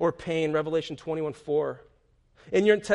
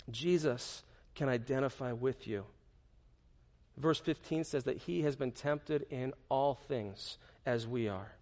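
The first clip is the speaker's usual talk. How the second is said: hushed; 4 semitones lower, 7 dB quieter than usual. Real, not acted. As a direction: drop to a near hush. Sudden hushed preaching.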